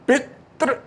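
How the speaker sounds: पितृ with the first syllable hit, tiny break before तृ; stress in पितृ. Shown as a demonstration. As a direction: punch the first syllable of पितृ, pitched high. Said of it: "बलाघात हो रहा है".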